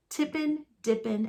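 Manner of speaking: ordinary delivery, nothing marked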